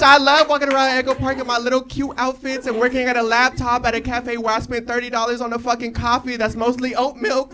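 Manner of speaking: normal talking voice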